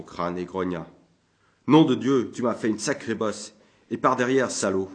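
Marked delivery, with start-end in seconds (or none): 1.7-4.9 angry cursing. Acted. Sore drunk sergeant cursing the priest.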